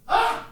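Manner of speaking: short high-pitched yelp imitating mom; a sudden high "Ah!" to startle a dog; imitated scream. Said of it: "who is this ninja screaming at us".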